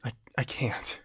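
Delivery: very soft, halting murmur; faint protest. Acted. Frozen on the verge of a breakdown, faint and halting.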